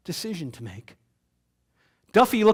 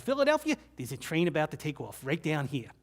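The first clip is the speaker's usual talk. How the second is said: put-on New York accent; 5 dB quieter than usual. Amtrak woman pointing out the train, light New York accent. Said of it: "in her finest New York accent".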